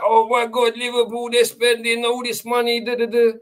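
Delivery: high, breathless fake gushing over the spending; mocking excited fans. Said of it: "And people are like".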